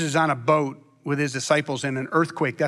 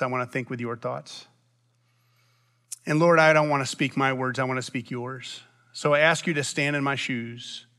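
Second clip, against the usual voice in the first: spoken prayer; 2 semitones lower than usual. Real, not acted. Leads the congregation in prayer.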